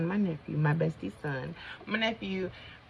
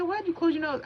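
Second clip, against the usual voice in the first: much higher-pitched quoted question; baffled onlookers; 12 semitones higher than usual. Retold or imitated. Mom and bestie questioning her tissue use, high imitation. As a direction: imitate puzzled helpers, pitched way up. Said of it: "and they said".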